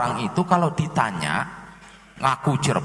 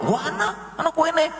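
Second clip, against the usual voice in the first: much higher surprised exclaim; mock surprise; 13 semitones higher than usual. The local spotting the odd cake, high mock surprise. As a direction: exclaim high as if caught off guard.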